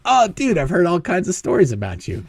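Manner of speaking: high, excited fan-like greeting; retold excited recognition; acted amazement. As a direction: start high on a mock-awed exclamation. Acts delighted greeting to Barney.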